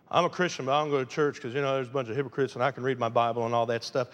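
low, fast, dismissive mock voice of a lazy believer; mocking excuse. Preacher mocking churchless Christians, lazy dismissive mimicry. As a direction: rattle off the excuse like you don't care.

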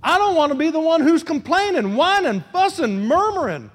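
preacher giving the church a prayer to say; model prayer. Acted. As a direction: pray it out for them.

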